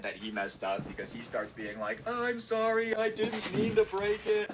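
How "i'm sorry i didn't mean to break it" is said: put-on high, loud wailing kid voice; mock whiny apology. Kid seen throwing the iPad tries apologizing, mock wail. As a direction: wail it like a caught child.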